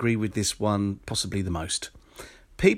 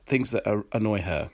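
usual speaking voice

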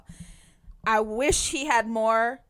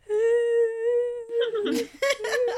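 laugh-cry; 12 semitones higher, 4 dB louder than usual. Retold or imitated. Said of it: "then I was just like".

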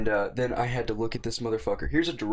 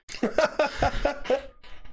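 bursts out laughing high; a real, high-pitched laugh; cracking up mid-story. Real, not acted. Laughing at his own story.

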